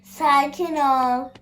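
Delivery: slow, drawn-out "Sakin ol" stretched gently; slow soothing "Sakin ol" to the startled game character. Acted. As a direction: ease "Sakin ol" out slowly to calm her. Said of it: "bir şey yok abla".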